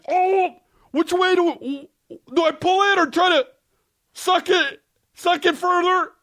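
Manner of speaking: put-on high, very slow, puzzled child voice; a kid lost over stringy cheese; high, slow kid voice fretting over the cheese; panicked kid. Acting himself as a kid.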